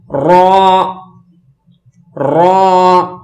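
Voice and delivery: را full and rounded, almost "raw", held long; thick heavy articulation. Shown as a demonstration. Teacher models the green letter, thick drawn-out را. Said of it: "موٹا کر کے پڑھیں گے".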